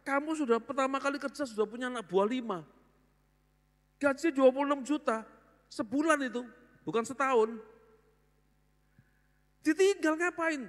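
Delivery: high, exasperated father pleading; can't believe the son quit; father bewildered that his son quit a big salary, high; baffled father. Retold or imitated. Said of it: "Papa nih bingung".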